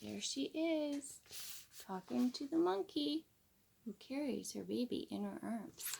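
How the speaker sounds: hushed picture-book aside; soft aside. Real, not acted.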